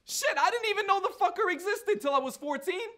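loud, high-pitched protest; indignant exclamation. Acted. Nigel insisting he never knew his brother existed, indignant. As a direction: burst out in high, loud disbelief.

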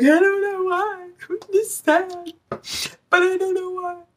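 high whiny fake crying, slowing down; mock weepy whine. Tarot reader mocking her younger self crying every morning, high whiny voice. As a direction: imitate your younger self sobbing for no reason. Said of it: "start crying and be like".

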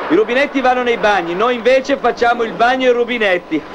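ordinary talk, nothing marked

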